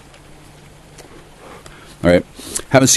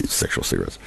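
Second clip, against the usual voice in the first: breathy whisper of the words; 13 dB quieter than usual. Pretend. Repeating his joke phrase in a sudden whisper. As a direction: drop to a whisper.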